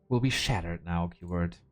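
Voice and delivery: low, gloomy doom-laden drop; emo doom. Acted. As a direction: sink the voice into mock tragedy. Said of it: "gotta lead with the emo version".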